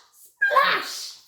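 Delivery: very high, explosive burst; big splash. Shown as a demonstration. Storyteller leading children in a sound effect, big splash. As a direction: make the word crash like water.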